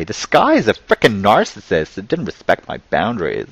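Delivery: hammed-up, swooping mock complaint; mocking accuser. Ridiculing someone who blames the sky for rain, mock-indignant. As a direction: play up the absurd accusation. Absurd example of blaming the sky.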